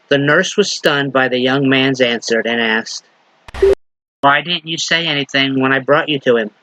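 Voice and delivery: normal talking voice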